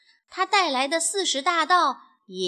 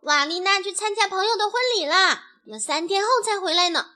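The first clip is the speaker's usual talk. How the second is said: higher, louder, mildly cross explanation; exasperated outburst; 8 semitones higher, 5 dB louder, faster than usual. A narrator's reading. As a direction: let irritation push the voice up. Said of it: "卡门忍不住发火了".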